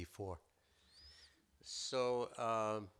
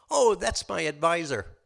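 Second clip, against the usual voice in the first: higher, louder casual reveal; rabbit punchline; 7 semitones higher, 10 dB louder than usual. Acted. Rabbit explaining to the wolf that the bear is his advisor. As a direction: deliver the punchline breezily.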